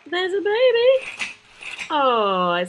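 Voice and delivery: high, slow cooing. Real, not acted. Announcing the newborn to mummy, high slow coo.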